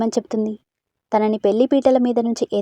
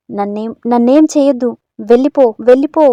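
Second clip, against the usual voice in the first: loud, panicked pleading to go away; frightened shout; 8 dB louder than usual. Acted. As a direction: yell it at the ghost in terror. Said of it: "అమృత గట్టిగా అరిచింది".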